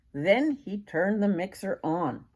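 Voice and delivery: plain reading voice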